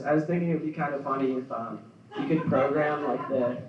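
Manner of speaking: normal talking voice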